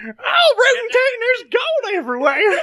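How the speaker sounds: put-on high, shouting old-timer cry; old prospector. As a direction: whoop it like a giddy old miner. Prospector character continues.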